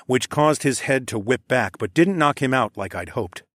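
ordinary delivery, nothing marked

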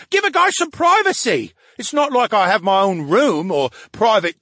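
high, loud, complaining sidekick voice; a needy brain in a tank griping at his friend; whiny brain voice. Acted.

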